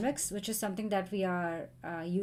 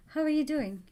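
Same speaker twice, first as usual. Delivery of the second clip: usual speaking voice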